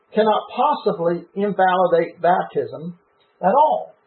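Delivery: usual speaking voice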